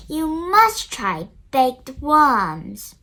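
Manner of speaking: put-on a boy hamming up fancy manners; posh mock voice. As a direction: play a grand posh lady, pinky up. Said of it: "he says".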